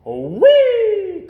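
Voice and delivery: high, loud, gleeful squeal; whooshing down the banister; gleeful whee. A narrator's reading. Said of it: "cried Rabbit".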